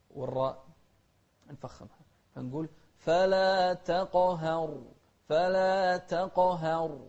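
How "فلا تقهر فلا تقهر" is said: loud chanted تقهر, full ق, thinned ه, heavy ر; heavy qaf, light haa. Shown as a demonstration. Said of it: "والقاف هني قلقلة مع تفخيم".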